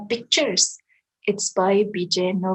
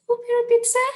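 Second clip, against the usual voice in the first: high squeaky disbelief; mock disbelief; 14 semitones higher, much faster than usual. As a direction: shriek the question. Incredulous at the text.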